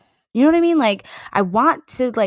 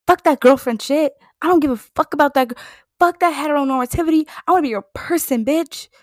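high, fast, loud outburst; defiant rant; 7 semitones higher, 3 dB louder, faster than usual. Acted. Fan ranting her own version of the love lyric, defiant.

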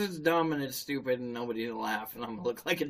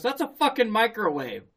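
higher and louder, fed-up exasperation; exasperated punchline; 8 semitones higher, 5 dB louder than usual. Retold or imitated. The bartender tells the customer, exasperated and loud. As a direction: snap the answer like he can't believe it. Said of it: "And bartender goes".

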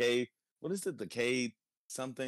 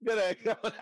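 high-pitched mock British greeting; British accent; 11 semitones higher than usual. Teasing the co-host's accent, high mock British accent. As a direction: echo it in a silly, much higher British accent. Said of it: "British people and their funny talk".

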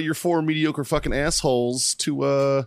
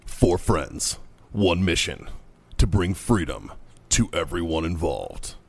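low, slow trailer narrator with dramatic pauses; movie trailer voice; 7 semitones lower, slower than usual. Pretend. Host opening the podcast as a mock epic, movie trailer voice. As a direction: intone it like a film trailer announcer. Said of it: "Doing a thing for the podcast".

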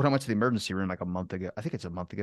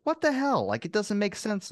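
exasperated; 9 semitones higher than usual. Retold or imitated. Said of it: "angrily, like, just mad at it".

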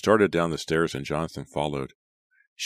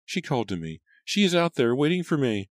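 tearful mother; 9 semitones higher than usual. A narrator's reading. Mother tearful over dead daughter.

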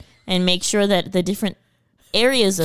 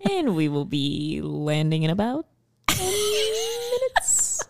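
soft, laughing flight-deck voice; pilot announcement; 5 dB quieter than usual. Pretend.

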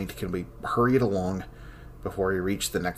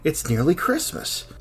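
higher and louder, a delighted outburst; joyful cry; 8 semitones higher, 5 dB louder than usual. Acted. Scholar alone in the archives, crying out into the dark, joyful. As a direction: burst out with sudden Christmas joy. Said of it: "he cries into the dark".

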